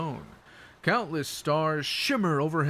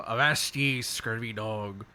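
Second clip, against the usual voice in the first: light pirate accent on a stock phrase; 4 semitones lower than usual. Shown as a demonstration. Streamer showing the pirate phrases they know, light pirate accent. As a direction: toss off a sample pirate accent. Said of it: "to use with a pirate accent".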